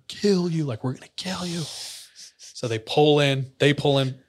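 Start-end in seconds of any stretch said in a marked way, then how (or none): 0.1-1.6 raised-pitch, held-back imitation of a threat; retold menace, higher and restrained; mock threat. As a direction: threaten like the aggressor, without full volume. Retold threat from chasing men.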